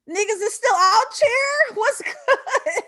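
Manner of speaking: high, loud, laughing and crying out; laughing triumph. Real, not acted. Speaker celebrating survival, laughing loud and high.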